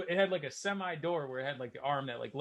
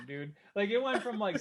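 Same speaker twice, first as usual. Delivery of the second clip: ordinary delivery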